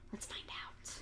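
hushed secret whisper. Shown as a demonstration. Storyteller whispering to the kids before the tale. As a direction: lean in and whisper it. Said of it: "whisper to kiddos".

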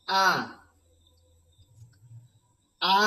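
আ said twice, slow and clear, for the class to echo; teacher models আ for students to repeat; repeat after me. Shown as a demonstration. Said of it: "আমার সঙ্গে সঙ্গে আপনারা উচ্চারণ করবেন".

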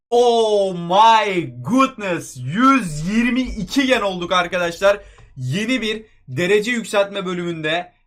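slow roaring announcement of a win; triumphant shout. Real, not acted. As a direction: stretch every phrase in a huge hype yell. Celebrates reaching the wanted upgrade.

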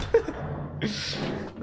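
airy high giggle; breathless laugh. Real, not acted. Imagining viewers' faces, breathless laugh. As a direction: let a breathy laugh escape.

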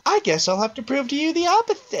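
put-on high-pitched cat-boy voice; character voice. Voicing the cat-human character.

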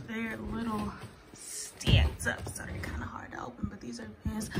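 soft breathy aside; whispering. Real, not acted. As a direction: drop to a whisper. Whispered aside while filming.

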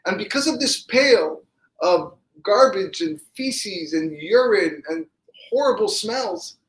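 anguished protest. Acted. Crying brother answers the rebuke from the cell, anguished protest. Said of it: "he started crying, bawling".

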